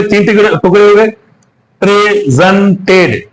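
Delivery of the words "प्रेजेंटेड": प्रेजेंटेड slowly in three beats, pre, sen, ted; slow, segmented प्रेजेंटेड; split into syllables. Shown as a demonstration. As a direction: break प्रेजेंटेड into three separate pieces. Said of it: "तीन टुकड़े हो गए".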